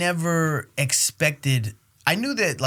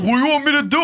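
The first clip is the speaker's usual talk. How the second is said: comic's defensive reply; 9 semitones higher, 4 dB louder, much faster than usual. Retold or imitated. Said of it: "he's like".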